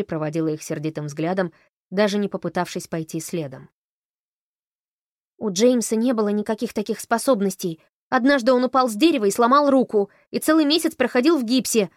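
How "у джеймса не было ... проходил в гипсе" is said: loud, higher outraged complaint; as if she was cheated; indignant. A narrator's reading. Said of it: "сказала она возмущённо".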